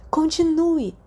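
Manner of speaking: excited whisper. A narrator's reading. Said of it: "Sirius sussurrou sua voz alta de excitação".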